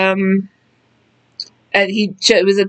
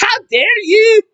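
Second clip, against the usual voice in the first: very high, wailing mock-Irish that lands in Scottish; hammed-up Celtic accent, pitched sky high; bad Irish-turned-Scottish accent; 14 semitones higher, 4 dB louder than usual. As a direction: overdo an angry Irish accent in squeaky falsetto. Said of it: "And that's Scottish".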